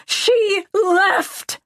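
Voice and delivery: very high, drawn-out yell; high-pitched desperate shout. A narrator's reading. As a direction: scream it out in anguish. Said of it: "I yell".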